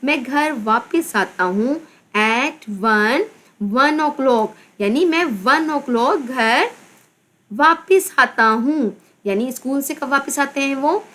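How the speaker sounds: ordinary delivery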